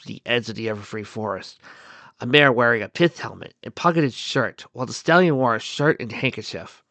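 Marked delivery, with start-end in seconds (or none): none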